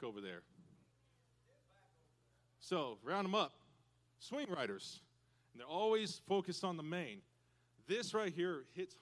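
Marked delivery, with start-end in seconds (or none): none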